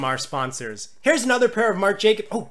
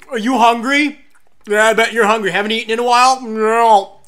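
loud, hammed-up teasing; mock taunting; 7 dB louder than usual. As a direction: taunt a hungry person with food. Acts out taunting a hungry person.

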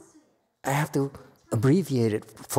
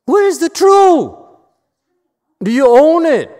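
challenging shout; 13 dB louder than usual. Real, not acted. Teacher challenges the students.

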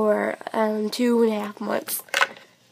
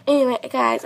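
normal talking voice

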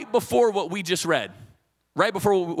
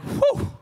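high-pitched mock-spiritual "whew". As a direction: let out a high, exaggerated "whew". Acting over-spiritual moment.

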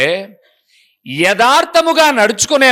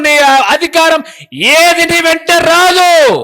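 rising, louder preaching, slow at the end; preaching at full force; 5 semitones higher, 3 dB louder, slower than usual. Acted. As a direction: build the sermon to a ringing climax. Preacher building to climax.

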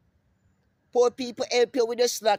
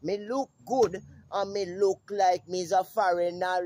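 ordinary talk, nothing marked

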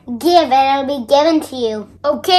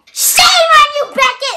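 indignant scolding; 10 semitones higher than usual. Acted. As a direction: scold him, outraged.

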